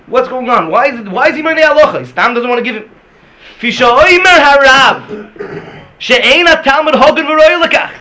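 loud, high-pitched exclamation; impassioned preaching. Acted. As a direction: thunder the question and the quoted verdict. Preaches at full force.

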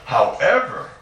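"however" punched, pitch leaping on "ever"; stress on however. Real, not acted. Rabbi repeating the caveat to students, emphatic "however". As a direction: hit "however" hard, swooping up then down. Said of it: "I stress the word however".

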